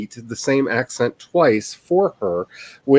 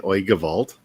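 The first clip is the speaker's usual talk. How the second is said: Russian accent hook phrase; lightly Russian-accented exclamation; 3 semitones lower than usual. Shown as a demonstration. Showing the teacher's hook phrase, Russian accent. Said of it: "get into a Russian accent".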